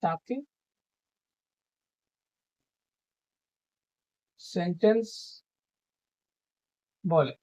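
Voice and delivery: slow, word by word, long pauses; teacher dictating a definition; dictation. Shown as a demonstration. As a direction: dictate so students can write it down.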